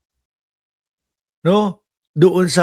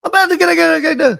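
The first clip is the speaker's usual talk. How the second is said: irritated yell; 11 semitones higher, much faster than usual. Retold or imitated. Caller losing his temper at the 911 dispatcher, high irritated yell. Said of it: "Umiinit yung ulo ko".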